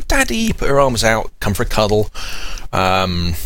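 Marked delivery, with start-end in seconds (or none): 0.1-0.5 put-on high childlike call of daddy; excited toddler voice greeting her father; little girl calling daddy. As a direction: play the toddler spotting her dad. Toddler greeting dad at car.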